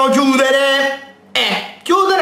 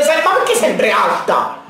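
usual speaking voice

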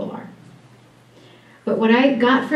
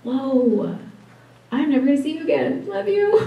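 mock goodbye; 4 semitones higher, 4 dB quieter than usual. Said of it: "And I was like".

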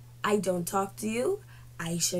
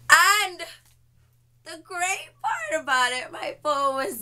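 sarcastic wail; 7 semitones higher than usual. Real, not acted. Telling viewers her bad luck, a mock wail. Sarcastic build-up, cry heard.

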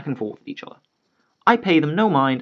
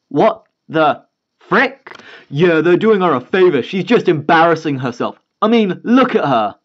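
scoffing dad; 3 semitones higher, 4 dB louder than usual. Acted.

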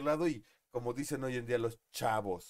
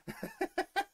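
high laugh. Real, not acted. After his own joke.

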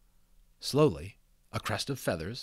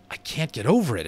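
awestruck admiration; 4 semitones higher, 7 dB louder than usual. A narrator's reading. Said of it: "with such awestruck admiration in his voice".

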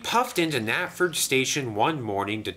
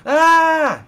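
very high, loud startled scream; 14 dB louder than usual. A narrator's reading. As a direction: shriek in sudden terror.

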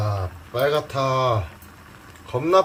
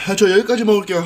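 ordinary talk, nothing marked

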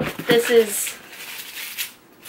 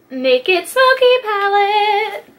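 high, drawn-out gush; gushing excitement; 10 semitones higher, slower than usual. Real, not acted. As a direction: stretch out the name in a delighted high voice. Gushing over the new palette.

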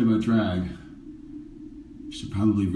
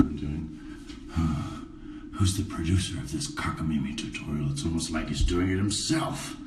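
sarcastic grumble; 6 semitones lower, 7 dB quieter than usual. Pretend. Sarcastic mutter at producer.

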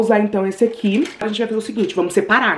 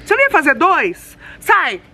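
high, loud scolding; raised voice, exasperated order; 6 semitones higher, 4 dB louder than usual. Real, not acted. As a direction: scold the dog and shoo it off. Scolding the dog, shooing it off.

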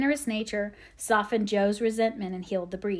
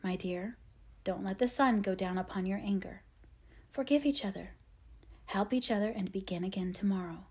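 gentle whisper; 2 semitones lower, 8 dB quieter than usual. A narrator's reading. Mother at the good-night kiss urging forgiveness, whispering gently. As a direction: counsel tenderly in a hushed voice. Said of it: "Missus March whispered gently".

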